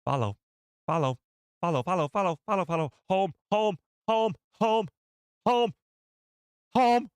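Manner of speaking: high, rising creature squawks; trash crab impression. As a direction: squawk it like a little crab monster. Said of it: "Trash crab impression, please".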